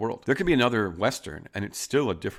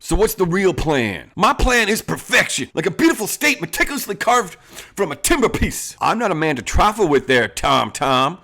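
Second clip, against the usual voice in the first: loud, high hammed-up outlaw boss; Western outlaw boss; 9 semitones higher, 8 dB louder than usual. Boss bragging about his plan to the gang, in a loud, high hammed-up outlaw voice. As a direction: play the swaggering bandit chief to his gang. Bandit squabble over names and plans.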